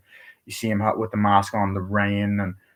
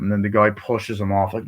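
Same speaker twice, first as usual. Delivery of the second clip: normal talking voice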